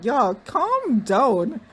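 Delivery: very slow, drawn-out calm down. Retold or imitated. Telling the fawning cast to calm down, very slowly. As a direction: stretch every word to settle them.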